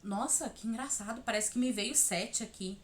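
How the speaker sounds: voiced inner thought, kept low; acted quiet realisation. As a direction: play a small, private discovery. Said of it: "Talvez ele vai te trazer um número".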